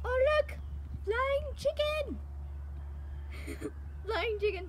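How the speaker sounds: real excited squeal. Real, not acted. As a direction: cry out in delight. Sees a chicken fly.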